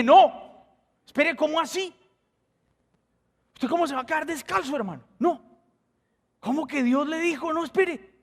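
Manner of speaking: high, urgent disbelief; alarmed protest. Retold or imitated. Pastor protests as stranger offers his own shoes, alarmed and high. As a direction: refuse in a rush, pitch way up.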